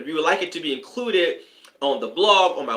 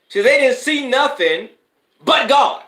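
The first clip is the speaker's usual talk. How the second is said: higher and louder, preaching it out with joy; spirit-quickened exclamation; 9 semitones higher, 6 dB louder than usual. Real, not acted. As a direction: cry it out like a praise break, higher and louder. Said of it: "See, I, I feel it already".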